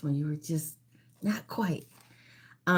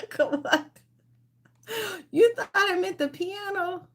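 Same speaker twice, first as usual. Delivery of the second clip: high-pitched, laughing protest; laughing disbelief. Real, not acted. Host teasing players over the piano mix-up, laughing. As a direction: protest through real laughter, voice way up.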